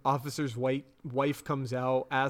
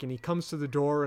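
usual speaking voice

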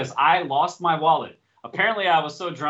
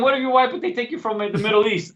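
louder, higher, pressing the point; arguing his point to a friend, raised and insistent; exasperated retort; 6 semitones higher, 4 dB louder than usual. Retold or imitated. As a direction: push back, voice up. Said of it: "I was like".

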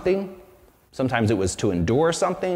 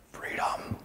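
whispered freedom; 12 dB quieter than usual. Retold or imitated. Preacher quoting Braveheart to congregation, whispered finish. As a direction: whisper the last word, hushed and intense. Climax of quoted battle speech.

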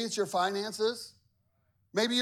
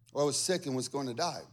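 ordinary talk, nothing marked

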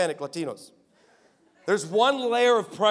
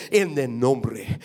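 fiery Spanish prayer; 5 semitones lower, slower than usual. Retold or imitated. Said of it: "when that thing switches over to Spanish".

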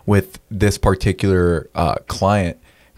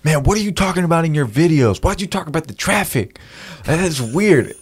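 imitating a friend, higher-pitched griping; baffled complaining; 8 semitones higher than usual. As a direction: act out a puzzled buddy's pestering.

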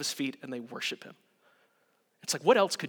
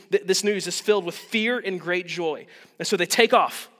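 ordinary talk, nothing marked

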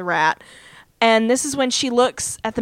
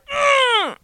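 high drawn-out "mmm" noise; imitated high hum; 14 semitones higher than usual. Podcaster imitating the noise she makes at the rat, high drawn-out hum. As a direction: imitate her with a long, high-pitched hum.

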